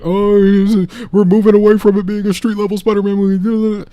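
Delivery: high, loud whiny fan panic; mock the worried fans; mock panicked fan. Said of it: "people freak out about".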